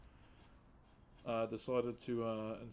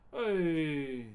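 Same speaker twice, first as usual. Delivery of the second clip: calling out; 2 semitones higher, 7 dB louder than usual. Real, not acted. Greeting a viewer on stream.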